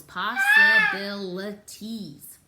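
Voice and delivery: Possibilities drawn out slowly and loudly, one syllable at a time. Shown as a demonstration. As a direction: sound out every syllable of Possibilities, slow and loud. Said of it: "It has a lot of syllables".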